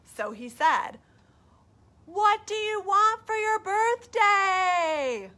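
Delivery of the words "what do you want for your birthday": high, loud, slow call. Acted. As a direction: call the question up slowly. Bear calling to moon.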